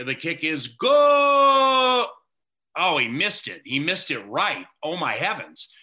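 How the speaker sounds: half-hearted replay of the radio call; replaying his own call. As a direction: lightly relive calling the kick good, then the miss. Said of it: "and I said, basically".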